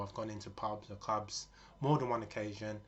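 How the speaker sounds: ordinary delivery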